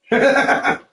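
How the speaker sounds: high bursting laugh. Real, not acted. Laughing after story.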